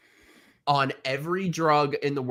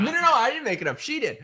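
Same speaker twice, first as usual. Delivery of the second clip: laughing; 10 semitones higher, faster than usual. Real, not acted. Laughing denial.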